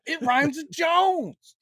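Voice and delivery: high, slow playful shout; joking shout. Acted. Explaining gones to the cohost, high slow playful shout. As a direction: sing it out as a silly punchline.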